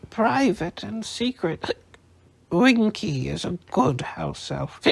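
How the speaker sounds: high, slow, slurred with hiccups; drunk hiccuping elf. A narrator's reading. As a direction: hiccup through it, sulking.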